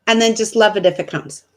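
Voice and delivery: ordinary talk, nothing marked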